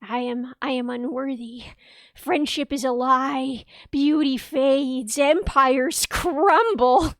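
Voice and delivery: mock-tragic spiral, drawn out very slowly; Batman-style brooding. Streamer mocking their own spiral of unworthiness, slow melodramatic brooding. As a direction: intone each doom-laden phrase with slow, hammed-up gloom. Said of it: "fall into the Batman spiral of".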